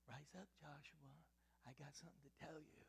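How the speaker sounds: soft, hushed whisper; gentle whisper. Shown as a demonstration. The way God did not speak to Joshua, a soft whisper. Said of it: "I don't believe he said".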